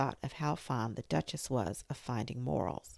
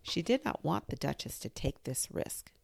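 usual speaking voice

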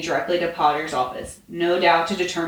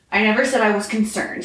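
stiff, higher-pitched denial; 4 semitones higher, 4 dB louder than usual. A narrator's reading. Man denying concern to his officemate, stiff. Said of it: "he informed her stiffly".

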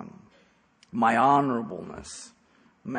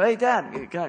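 a hint of a casual son, voice unchanged; just ask Dad normally; plain voice to dad; 7 semitones higher, 6 dB louder than usual. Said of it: "Your voice doesn't change".

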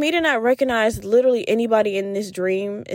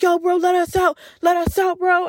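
higher, urgent mock-macho calling; put-on macho dude, pitched up; acting macho; 7 semitones higher than usual. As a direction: imitate a macho bro demanding out. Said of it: "he started acting all macho".

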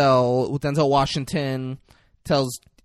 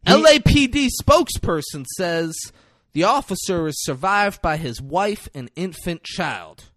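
raised-pitch newsreader impression; mock news report; 5 semitones higher than usual. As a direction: announce it like a TV news bulletin. Character recites imagined news report.

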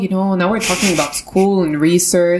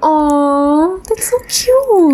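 very high, slow, cooing; gushing aw; 9 semitones higher, 4 dB louder, much slower than usual. Real, not acted. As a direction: coo it like something adorable. Reacts to "you're my soulmate.

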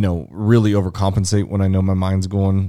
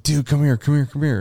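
high, quick urging voice; acting out a friend calling him over; urgent beckoning; 6 semitones higher than usual. Friend calls the dreamer over, urgent beckoning.

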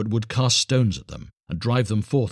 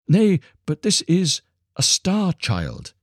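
slow, high, wondering reveal; awed woodcutter; 10 semitones higher, 6 dB louder, slower than usual. A narrator's reading. Woodcutter telling his wife of the star child, slow and awed. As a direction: lean into the marvel, slowly.

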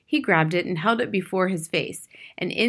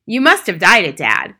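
lightly voiced son, louder; 10 dB louder than usual. A narrator's reading. Son remarks on Dad's dyed hair, exclaiming loudly. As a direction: exclaim at your father, voice falling on "Dad".

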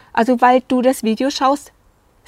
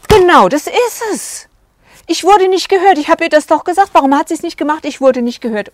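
agitated outburst; 7 semitones higher, 4 dB louder than usual. Retold or imitated. Client telling the coach nobody heard him, agitated loud outburst. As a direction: burst out, finally feeling understood.

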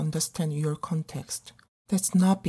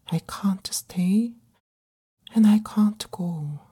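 whisper. Acted. Lost, belonging nowhere.

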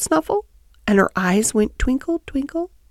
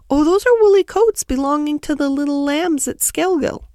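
higher, eager hedgehog washerwoman; obliging Tiggywinkle; 6 semitones higher than usual. Acted. Tiggywinkle tells Lucy about the woolly coats, obliging hedgehog. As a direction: answer in a high, put-on, helpful voice.

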